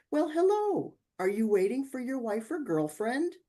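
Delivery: high, bright flirty greeting; flirty hello. Retold or imitated. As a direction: come on sweetly to a stranger. Flirty approach, quoted self.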